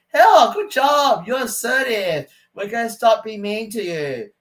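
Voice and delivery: mocking sing-song approval; mock praise. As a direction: sneer a slow fake congratulation. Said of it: "they're gonna say".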